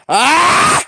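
mock monster scream; imitated Genji scream. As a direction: let out a sharp battle shriek. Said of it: "Genji teriakannya mana ya?".

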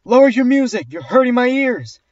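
high, loud dramatic complaint; imitated yelling. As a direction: mimic a woman yelling in outrage. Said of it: "And she also yelled".

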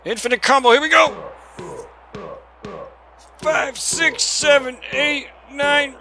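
high, loud shouted count; excited counting. Real, not acted. Gamer counting his combo hits, excited shouting. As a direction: call out each hit like a hype man.

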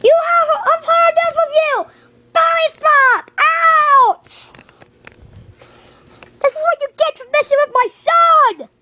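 high, angry battle shout; play-fight fury. Pretend. Parent punishing whoever messed with their son, high mock-furious shout. Acted fight, fed up with enemy.

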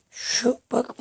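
ordinary talk

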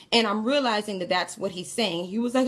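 ordinary talk

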